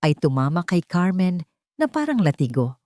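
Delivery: ordinary delivery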